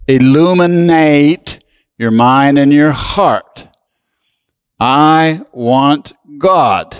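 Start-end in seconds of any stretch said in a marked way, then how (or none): 4.8-6.9 slow declaration. Shown as a demonstration. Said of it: "Say it with me".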